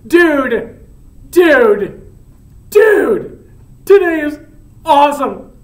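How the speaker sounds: high, loud, laughing giddiness; bursting with a secret, laughs between phrases; overjoyed dude, punching each word; super happy. Acted. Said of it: "I'm super happy right now".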